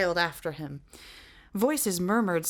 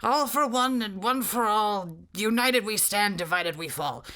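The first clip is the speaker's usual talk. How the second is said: giant shouting password; 4 semitones higher, 3 dB louder than usual. Acted.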